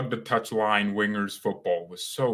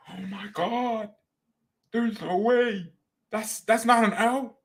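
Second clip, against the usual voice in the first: high-pitched, drawn-out amazement; stunned disbelief; 11 semitones higher, slower than usual. Real, not acted. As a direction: let the shock stretch the words out. Stunned by misreading the name.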